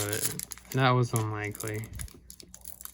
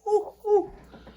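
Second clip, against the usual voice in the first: pained laugh; 4 dB louder than usual. Real, not acted. Laughing through toe pain.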